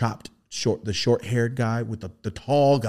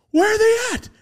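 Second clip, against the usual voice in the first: very high, louder eager question; curious beings; 7 dB louder than usual. Retold or imitated. The beings ask where the friend is, high eager question.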